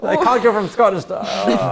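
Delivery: high, loud mock Scottish; Scottish accent. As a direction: slur it in a hammy Scottish accent. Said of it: "He was kind of talking like that".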